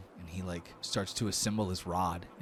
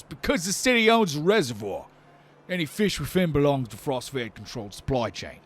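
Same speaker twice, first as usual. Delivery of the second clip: loud, raised officious voice; stern Minotaur guard; 8 semitones higher, 11 dB louder than usual. Acted. Guard warns Lortho about poaching, stern loud voice. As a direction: lay down the law loudly, peaking on the threat.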